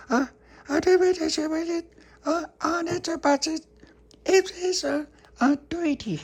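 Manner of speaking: put-on very high toddler babble; baby voice. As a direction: squeak it out like a tiny baby. Baby Lucy speaking, toddler voice.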